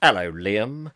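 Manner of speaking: low put-on voice for a hello; playful greeting. Streamer greets a viewer's second companion on request, low playful hello. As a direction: drop into a deep, drawn-out greeting. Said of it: "say hello to Jack and Liam".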